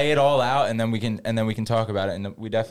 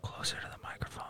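soft unvoiced whisper; 18 dB quieter than usual. Real, not acted. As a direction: whisper it close to the mic. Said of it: "we're whispering into the mics".

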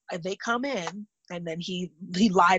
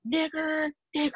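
mock yell of kids from the bus, pitched higher; imitating kids jeering out the bus window; retold yell; 9 semitones higher than usual. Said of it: "rolling down the window to yell at me".

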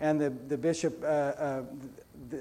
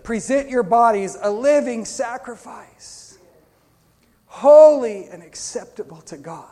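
fervent scripture; 4 semitones higher, 8 dB louder, slower than usual. Acted. Preacher quotes scripture forcefully.

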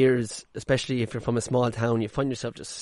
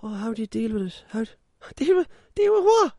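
mocking a clueless asker; 10 semitones higher than usual. Podcaster mimics people asking about his ADHD, rising to a laughing squeak. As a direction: voice climbing ever higher into a laughing squeak. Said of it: "people are like".

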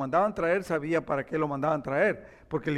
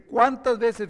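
raised, insistent question; acted exasperated outburst; harsh reply; 4 semitones higher, 6 dB louder, slower than usual. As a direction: demand it sternly. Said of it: "le responde con dureza".

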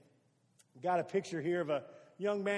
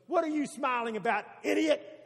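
retold snapping yell at a stranger, louder and higher; angry yell; 5 semitones higher, 5 dB louder than usual. Lady at the gas station yells angrily at Brian. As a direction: hurl it like an insult over your shoulder. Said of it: "She started yelling and cussing".

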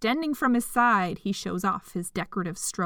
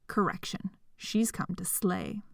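whispering; 3 semitones lower, 7 dB quieter than usual. A narrator's reading. Whispered aside in narration.

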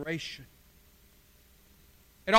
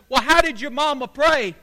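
exasperated question, higher and much louder; frustrated; 5 semitones higher, 9 dB louder than usual. Acted. Chaplain to the dying man after repeated interruptions, frustrated. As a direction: let frustration burst into the question. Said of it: "in a little bit of frustration".

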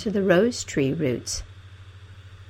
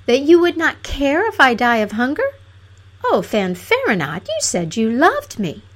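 higher princess voice, wounded; hurt, reproachful princess; 6 semitones higher, 5 dB louder than usual. A narrator's reading. Princess to the lover who doesn't care, reproachfully. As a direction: reproach him in a raised pitch.

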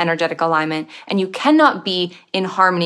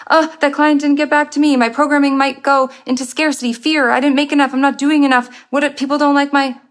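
anxious spiral; 6 semitones higher than usual. Pretend. Unaware mind spiralling into school memories, in a fast anxious voice. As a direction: let the worry run away with you.

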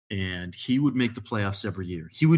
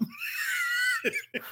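explosive laugh; big laugh; 4 dB quieter than usual. Real, not acted. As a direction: let out a huge whooping laugh.

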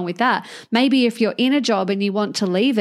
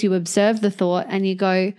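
ordinary talk

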